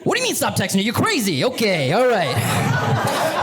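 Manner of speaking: high, swaggering bro voice; hometown guy voice. Pretend. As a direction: play the local hotshot hyping up a friend. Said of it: "I'm like".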